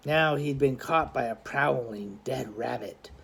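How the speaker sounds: usual speaking voice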